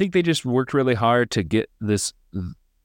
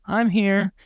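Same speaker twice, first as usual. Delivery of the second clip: much higher, breezy little announcement; mock girl voice; 12 semitones higher than usual. Mocking the flat character of the girl, high and chirpy. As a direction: chirp it like a bland young girl.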